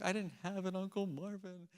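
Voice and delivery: a hint of a gloomy buddy whining; imitating depressed friend. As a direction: friend moaning after the speech, lightly mimicked. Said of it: "He's like".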